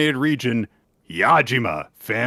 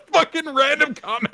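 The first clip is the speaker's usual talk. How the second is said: laughing; 4 dB louder than usual. Real, not acted. Laughing at the quote.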